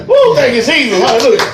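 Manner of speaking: real burst of joy to Jesus; joyful praise. Real, not acted. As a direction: shout praise with a laugh. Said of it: "praise outburst".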